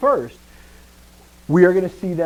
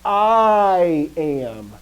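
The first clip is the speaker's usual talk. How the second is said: "I" loud and long, falling into am; a weighty, drawn-out stress on "I"; emphatic I; 3 semitones higher, 5 dB louder than usual. Retold or imitated. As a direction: hold and swell the word "I".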